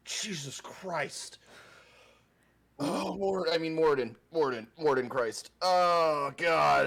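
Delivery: high, loud startled yelps. Real, not acted. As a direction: jump out of your skin. Startled by voices.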